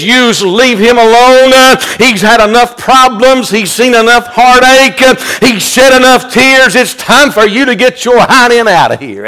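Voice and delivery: very high, loud rebuke of the devil; Jesus ordering the devil off, loud; fierce rebuke. Pretend. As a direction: run the devil out with every line. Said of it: "with a rebuke".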